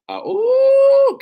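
very high, loud ecstatic "ooh"; soaring shouted ooh of rapture; ecstatic high cry. Real, not acted. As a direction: let out a rapturous yelp. Ecstatic outburst mid-sermon.

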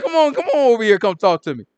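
loud, high-pitched beckoning; retold rousing "get over here" call; eager invitation. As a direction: call him over excitedly.

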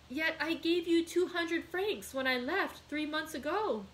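high-pitched, troubled protest; stammered dismay. A narrator's reading. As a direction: protest in bewilderment. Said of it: "stammered Dantès".

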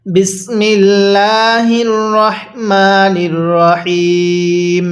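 slow, drawn-out tajweed recitation; Quran recitation. Shown as a demonstration. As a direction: recite the sura in full for revision. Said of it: "একবার revision দিব".

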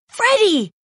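high-pitched cry of the name; excited cry. A narrator's reading. Pip calls to Freddy, crying out. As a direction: cry it out high, falling at the end. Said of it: "cries Pip".

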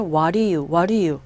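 "What do you" slurred together as "wah-ri-you"; low, quick "What do you" with a flapped d; reduced linked form. Shown as a demonstration. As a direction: link "What do you" into one word. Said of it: "이렇게 발음되거든요".

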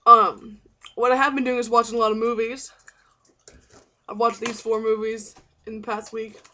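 usual speaking voice

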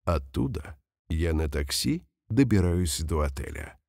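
ordinary delivery

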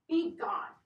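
sharp, loud, high outburst; furious dismissal; angry cry. Acted. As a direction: bark it at the door. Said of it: "cried the son".

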